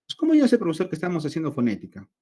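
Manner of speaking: high, loud jump into a student's voice; playing the curious student; mock student question.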